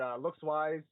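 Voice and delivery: ordinary delivery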